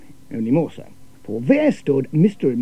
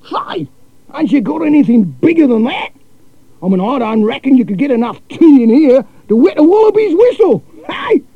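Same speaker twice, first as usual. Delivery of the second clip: brash Aussie cousin; 10 semitones higher, 9 dB louder than usual. A narrator's reading.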